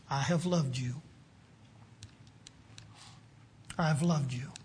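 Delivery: soft, low, gentle assurance; tender declaration. Retold or imitated. God reassuring the priests of his love, soft and tender. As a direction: drop to a low, hushed tenderness. God's love quoted amid the rebuke.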